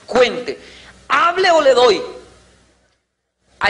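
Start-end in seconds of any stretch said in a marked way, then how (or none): 1.1-2.0 high, loud threat; threatening parent. Retold or imitated. Parent threatening the girl to talk, loud. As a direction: bark the threat at the child.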